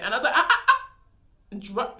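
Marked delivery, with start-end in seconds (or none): 0.4-0.9 high, loud awkward fake laugh; nervous high laugh. Telling the driver she just wants home, a nervous laugh.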